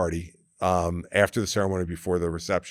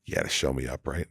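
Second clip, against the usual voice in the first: retold whisper; 7 semitones lower, 6 dB quieter than usual. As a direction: lower and softer, a mock hushed aside. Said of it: "He's whispering".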